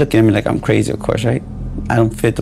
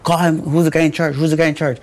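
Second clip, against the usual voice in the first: retold relentless demand, higher pitch; insistent pressing; 5 semitones higher than usual. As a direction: repeat it without letting up, as retold. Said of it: "I'm, like, literally pressing him".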